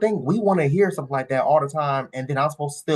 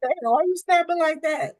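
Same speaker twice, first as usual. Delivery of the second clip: much higher, teasing disbelief; mock shock; 11 semitones higher than usual. Real, not acted. Teasing the woman in the clip, high mock shock. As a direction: squeak it out amused.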